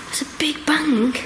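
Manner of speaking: tense whisper; hushed whisper. Real, not acted. Ghost hunter whispering to camera after a bang. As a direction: breathe it, scared.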